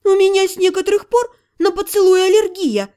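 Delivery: sharp, sarcastic and high; bilious retort. Acted. Heroine snaps back at Otto about the kiss, acid and high. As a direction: spit it out acidly. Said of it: "желчно ответила я".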